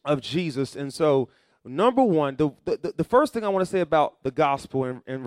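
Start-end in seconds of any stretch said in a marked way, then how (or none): none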